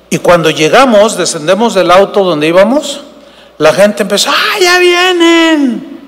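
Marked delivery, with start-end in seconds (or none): 4.3-6.0 high, slow, squealing mock fan; hammed-up squeal of an excited crowd. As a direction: shriek like a swooning fan. Screaming fans greeting preachers.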